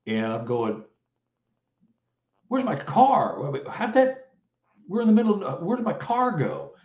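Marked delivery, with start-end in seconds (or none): 2.5-6.7 baffled, as if the car just vanished; bewildered panic. Retold or imitated. Husband in the ravine asking his wife, stunned panic.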